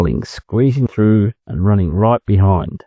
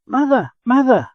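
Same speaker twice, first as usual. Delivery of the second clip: much higher calling out to mother; shouting together. Acted. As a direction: call across the river to mother. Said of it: "they shouted together".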